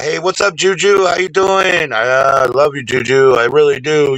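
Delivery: high, bright-eyed hello to a fan; excited greeting. Real, not acted. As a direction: gush over her. Greeting viewer Juju warmly.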